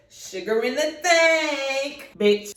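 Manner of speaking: very slow, drawn-out gloat; smug payback. Retold or imitated. Telling viewers her payback on the car, slow smug gloat. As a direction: savor the revenge.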